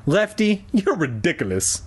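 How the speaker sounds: understated mock yell, high on the name; mildly cross, starting high; angry yell. A narrator's reading. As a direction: scold with a touch of anger. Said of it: "I yelled angrily".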